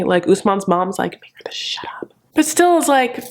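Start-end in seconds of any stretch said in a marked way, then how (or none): 1.2-2.1 hushed whispered order. Retold or imitated. Usman's mom telling him to quiet Lisa, whispered. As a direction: whisper it behind her back.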